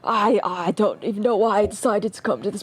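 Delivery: put-on a self-pitying old veteran rambling; very old man. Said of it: "let me get my old man voice".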